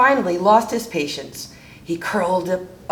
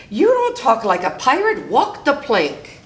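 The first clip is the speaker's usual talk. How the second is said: mildly shouted pirate order; 6 semitones higher than usual. A narrator's reading. Pete banishes the rascal, mild shouted verdict. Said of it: "cried Pete".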